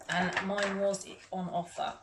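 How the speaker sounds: ordinary talk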